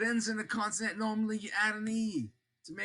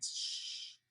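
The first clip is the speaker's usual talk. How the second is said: a soft unvoiced shush for the silent H; 9 dB quieter than usual. Shown as a demonstration. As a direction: hush like the letter makes no sound. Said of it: "is silent".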